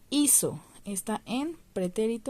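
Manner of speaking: ordinary delivery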